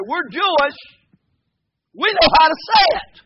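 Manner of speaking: high, loud, haughty boast; mock arrogant tone. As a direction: imitate someone loudly claiming superior knowledge. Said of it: "with an arrogant tone".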